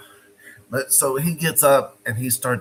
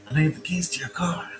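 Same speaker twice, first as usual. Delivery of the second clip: urgent unvoiced whisper; 4 semitones higher than usual. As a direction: hiss the demand secretly. Repeated as a whisper.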